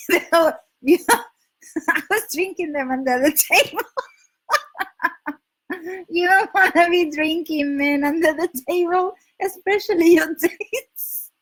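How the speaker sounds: giggling through the story; laughing. Real, not acted. Telling viewers about out-drinking men, laughing.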